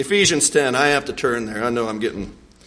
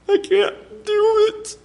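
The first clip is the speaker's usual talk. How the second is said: whiny refusal; slower than usual. Acted. Moses whining refusal.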